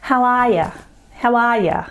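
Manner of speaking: "How are ya?" squashed to "how-a-ya"; Aussie schwa. Shown as a demonstration. Teacher demonstrating the schwa, quick "How are ya?". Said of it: "which looks like this".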